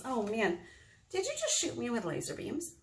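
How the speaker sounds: ordinary talk, nothing marked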